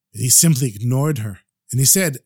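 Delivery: ordinary delivery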